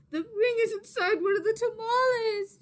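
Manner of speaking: high, loud panicked realisation; panicked thought. A narrator's reading. Maria discovering ring lost in tamales, high panic. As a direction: gasp it out in alarm. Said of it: "she thought".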